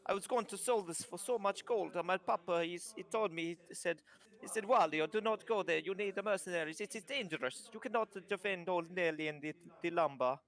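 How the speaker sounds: lightly voiced kobold with an Italian lilt; proud little trader showing off his wares; mock Italian kobold merchant boasting of his lumber; kobold's accent. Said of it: "He goes".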